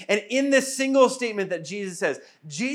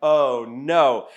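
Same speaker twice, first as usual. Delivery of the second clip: low, dreading groan of oh no; mock dread; 8 semitones lower, much slower than usual. Caught-out disciple facing Jesus, low mock dread. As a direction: sink into mock dread as he calls you over. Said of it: "You're like".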